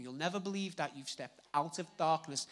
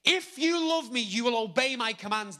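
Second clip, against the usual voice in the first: proclaiming scripture; 7 semitones higher, 10 dB louder than usual. Retold or imitated. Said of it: "The N I V says".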